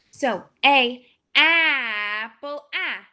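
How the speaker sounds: "apple" sung out with a rising, stretched a; teacher shows an extended vowel; extended vowel demo. Shown as a demonstration. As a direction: stretch the "ah" for students. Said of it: "My mouth opens pretty big".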